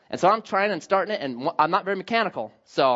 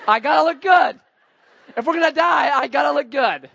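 higher-pitched impression of a little girl; seven-year-old daughter; 5 semitones higher, 4 dB louder than usual. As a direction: imitate a young daughter fussing over herself. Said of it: "she's, she's seven, she's doing this".

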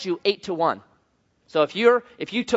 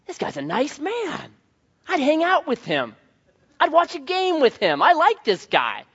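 amazed high musing; 10 semitones higher than usual. Retold or imitated. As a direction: marvel at how nice he is. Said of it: "I'm thinking".

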